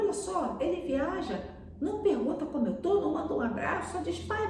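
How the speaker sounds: high-pitched grumbling dad; put-out father, pitched well up; indignant father. Retold or imitated. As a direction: complain like an offended father. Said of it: "o pai leu aquele telegrama e falou".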